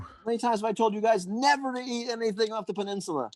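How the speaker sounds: high-pitched, exasperated mock telling-off; scolding. Acted scolding of the crew.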